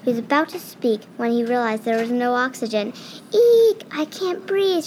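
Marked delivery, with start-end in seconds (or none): 3.3-4.8 high, loud panicked shriek; a mouse shrieking that he can't breathe. Acted. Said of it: "shrieked Ralph".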